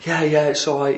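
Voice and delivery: mock placating. Placating the angry man.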